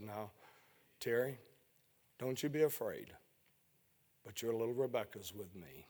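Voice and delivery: softly voicing the still small voice. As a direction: say it gently, barely above a breath. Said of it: "that small, still voice".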